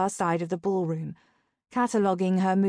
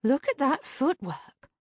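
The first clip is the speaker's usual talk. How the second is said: high-pitched admiring sidekick; impressed, lifted character voice; 6 semitones higher than usual. A narrator's reading. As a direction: admire it in a higher voice. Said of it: "Sabrina sounded impressed".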